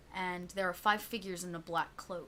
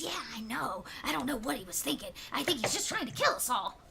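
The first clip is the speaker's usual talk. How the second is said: higher sneering goblin gossip; a goblin complaining about the boss, pitched up; 6 semitones higher than usual. Acted. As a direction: grumble like a scheming goblin at the fire. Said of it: "Another one goes".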